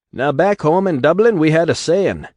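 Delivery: high, loud Irish brogue rally shout; bellowing Irish orator. Acted. Atlas rouses the crowd with a loud Irish brogue. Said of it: "bellowed Atlas".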